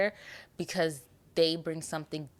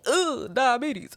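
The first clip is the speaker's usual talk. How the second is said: mock alarm; 8 semitones higher, 5 dB louder, slower than usual. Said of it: "I feel like".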